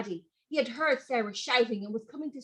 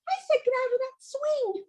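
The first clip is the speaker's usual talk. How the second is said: much higher insistent voice; high-pitched warning; 13 semitones higher than usual. Acted. Sister warning her brother off the swing, high and pleading. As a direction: plead high and firm, scared.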